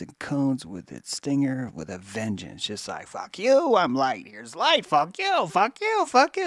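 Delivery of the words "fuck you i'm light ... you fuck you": shrill, much higher taunting voice of the light, rising on each fuck you; angry light voice. Acted. The light taunting the listener's burning eyes, high mocking jeers. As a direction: jab each insult at the eyes like a stinger.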